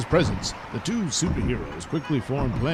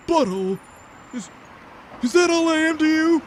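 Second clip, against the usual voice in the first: high, loud hurt cry; wounded; 13 semitones higher, 4 dB louder, faster than usual. Acted. Hero hurt at being called butthole, high wounded cry. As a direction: wail it, deeply offended.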